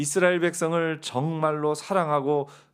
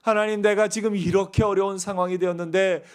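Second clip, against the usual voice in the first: louder, pleading reproach; an impatient believer's raised complaint; complaining to God; 5 semitones higher, 5 dB louder than usual. Retold or imitated. Believer urges God to hurry help, in louder, impatient plea. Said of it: "하면서 하나님을 원망할 때가".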